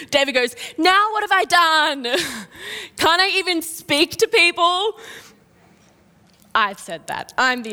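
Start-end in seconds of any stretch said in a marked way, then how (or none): 0.8-5.0 whiny sibling. Retold or imitated. David snapping back at his big brother, whiny. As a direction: whine like an annoyed little brother. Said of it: "most sibling response ever".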